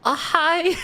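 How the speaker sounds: very high, loud mock-innocent greeting; squeaky little hello, on the edge of laughter; caught child. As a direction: play a tiny kid caught stealing a cookie. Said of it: "I was just like".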